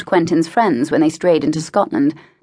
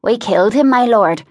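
higher and louder, blurting it; nervous confession; 5 semitones higher, 4 dB louder than usual. A narrator's reading. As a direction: get the bad news out fast. Confessing a killing to his lord.

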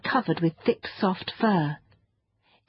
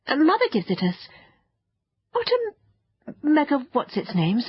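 put-on high-pitched child, stumbling over strange names; bright-eyed, baffled youngster asking; puzzled child; 6 semitones higher than usual.

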